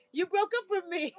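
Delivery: playful imitation of his European accent. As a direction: protest in a put-on European accent. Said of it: "an accent. So they were from, you know, Europe".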